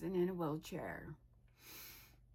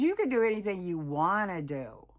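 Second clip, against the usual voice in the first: louder retold pep talk; rousing encouragement; 8 dB louder than usual. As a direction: cheer them on as the friend did, louder. Acting out friend cheering the speaker on.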